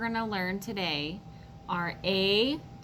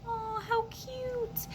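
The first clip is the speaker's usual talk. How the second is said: 높고 부드럽게, 천천히 길게 끄는 "Aw, how cute"; adoring aw how cute; 7 dB quieter, much slower than usual. Acted. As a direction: gush over a puppy, high and slow. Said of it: "sounded like you were going".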